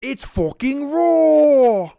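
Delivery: Gordon Ramsay yell. Retold or imitated. Gordon Ramsay beef Wellington joke.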